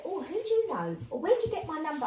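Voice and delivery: high-pitched put-on curiosity; prying questions, lifted high in mimicry; mock nosy questioning. Acting nosy questions she never asks.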